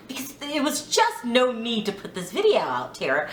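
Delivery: usual speaking voice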